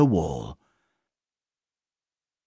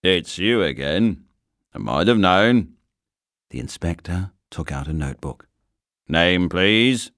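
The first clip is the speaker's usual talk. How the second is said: stern inspector; 3 semitones lower than usual. Acted. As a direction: play a weary station inspector. Inspector voiced in a story.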